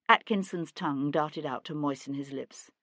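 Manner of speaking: ordinary delivery, nothing marked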